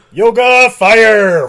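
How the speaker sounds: high, loud drawn-out attack shout; mock battle cry. Witnesses unleashing fire breath on security, mock battle yell. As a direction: bellow it like a video game move.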